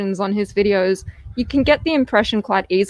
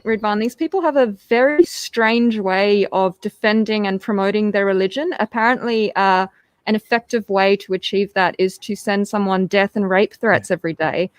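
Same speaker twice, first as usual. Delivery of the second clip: ordinary talk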